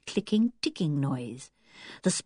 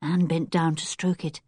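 usual speaking voice

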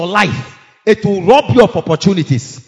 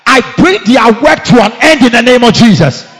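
loud command; 3 semitones higher, 10 dB louder than usual. Real, not acted. Repeated command, second higher.

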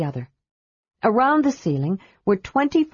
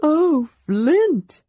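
high, breathless wonder; seeing the ruby roof; awed; 9 semitones higher, 3 dB louder than usual. Acted. Tas in awe.

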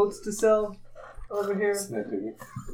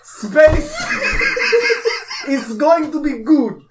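hammed-up Russian accent, raised and amused; bad Russian accent; 4 semitones higher than usual. Contestant sells cyborgs to players, hammed-up bad Russian accent. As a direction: push the sales pitch in a clumsy Russian accent. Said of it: "You have really bad accent".

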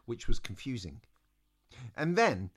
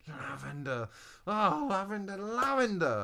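exasperated, rising higher; huffing; 10 semitones higher than usual. Acted. Eliza huffs over absent Lavender, exasperated. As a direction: huff the name in mounting frustration. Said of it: "Eliza huffed".

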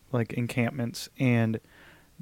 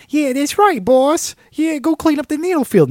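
very high, louder sidekick voice; tiny henchman sucking up to the boss, squeaky high; squeaky little henchman; 7 dB louder than usual. Pretend. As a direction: yap agreement like a tiny toady. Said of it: "he's like".

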